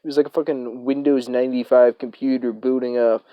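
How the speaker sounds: ordinary talk, nothing marked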